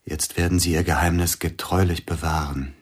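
ordinary delivery

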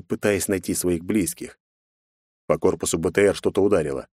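plain reading voice

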